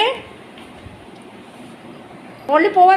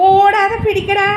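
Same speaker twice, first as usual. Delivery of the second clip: raised, high call; 6 semitones higher, 4 dB louder, slower than usual. Real, not acted. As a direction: call out an order during play. Calling out during ball play. (ഉയർന്ന സ്വരത്തിൽ വിളിച്ചുപറഞ്ഞ്)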